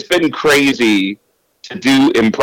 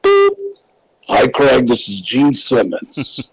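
[name] impression; 3 semitones higher, slower than usual. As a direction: leave a voicemail as [name]. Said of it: "Gene actually said".

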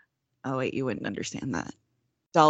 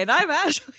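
bright podcast voice; 11 semitones higher, 5 dB louder than usual. Shown as a demonstration. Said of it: "You sound really upset".

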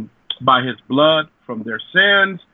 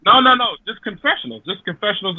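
playful protest; 7 semitones higher, faster than usual. Real, not acted.

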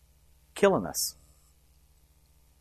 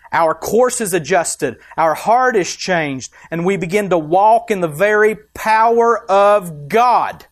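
loud climactic preaching; preaching at full force; 4 semitones higher, 6 dB louder than usual. Acted. Pastor preaching walking in God's power, loud climax. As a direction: build to the peak.

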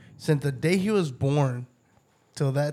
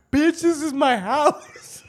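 mock indignant; 10 semitones higher, 4 dB louder, slower than usual. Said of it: "that shit was so fucking funny".

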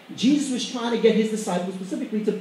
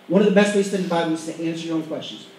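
brisk, lower-pitched run-through without a pause; quick repeat; 4 semitones lower, faster than usual. Shown as a demonstration. Preacher repeating a study tip to the congregation, quick and lower. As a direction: rattle the point off in one breath. Same point repeated faster.

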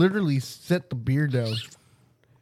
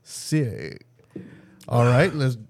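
normal talking voice

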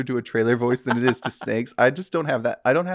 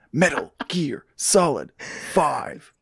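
bad fake Snake voice; 3 semitones higher than usual. Host opening the podcast in a mock Snake voice. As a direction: ham up a soldier growl for the podcast opener. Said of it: "my really bad, really bad fake Snake accent".

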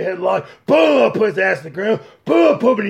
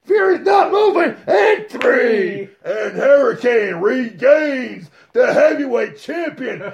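excited play-by-play; 5 semitones higher, slower than usual. Acted. Commentator calling the upset for the fans, shouting. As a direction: call the match live, shocked.

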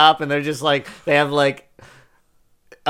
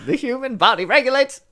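much higher reading voice; higher pitched; 10 semitones higher than usual. Shown as a demonstration. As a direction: push the pitch way up. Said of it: "not a falsetto".

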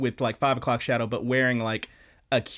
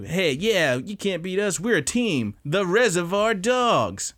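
mock boast pitched higher, slowing on the last words; higher, hammed-up team swagger dragged out at the end; cocky gang boast; 7 semitones higher than usual. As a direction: brag like a smug villain crew. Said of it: "and they say".

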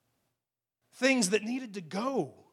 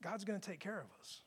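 compassionate; 2 semitones lower, 13 dB quieter than usual. Acted. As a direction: comfort them quietly, unhurried. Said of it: "It has compassion".

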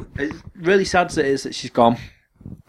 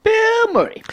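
shouted Bill Murray, pitched way up; excited; 3 dB louder, slower than usual. Real, not acted. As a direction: call out the name with glee.

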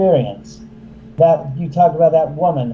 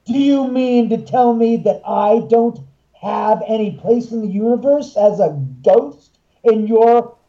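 mock spirit; 5 semitones higher than usual. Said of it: "the spirits say".

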